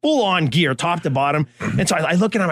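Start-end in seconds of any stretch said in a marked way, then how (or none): none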